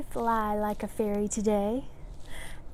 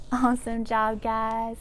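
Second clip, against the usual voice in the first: ordinary delivery, nothing marked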